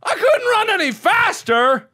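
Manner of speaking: very high, stretched yelling; incredulous protest. Real, not acted. Player caught while running full speed, incredulous protest.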